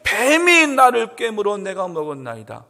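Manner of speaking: high, loud, blaming plea; Eve's excuse. Acted. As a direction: plead her innocence loudly. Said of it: "하와는 또 뭐래요".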